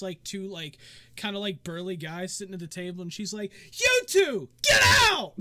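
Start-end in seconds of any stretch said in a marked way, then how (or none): 3.7-5.3 high, piercing acted shout; sudden full-force shriek of an order; shouted eviction. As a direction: yell across the tavern to throw them out. Dancer throws out two burly men.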